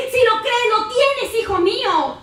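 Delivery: a hint of a tender Father, high and loud; the Father's voice. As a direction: answer as the Father. The Father answers believer. (voz del Padre respondiendo al creyente)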